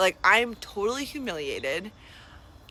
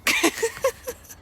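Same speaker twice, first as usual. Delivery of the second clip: incredulous laugh; 3 dB louder than usual. Real, not acted. Incredulous laugh after insult.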